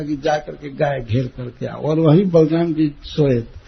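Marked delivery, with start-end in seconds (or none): none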